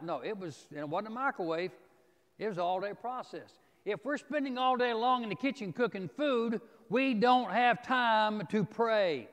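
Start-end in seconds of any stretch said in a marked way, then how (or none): 3.8-9.3 raised voice, slowing hard to punch each word; emphatic preaching. Real, not acted. Preacher warns congregation that cooking crowds out prayer, emphatic preaching. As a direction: build high and loud, then stretch out the point. Drives home the point on prayer.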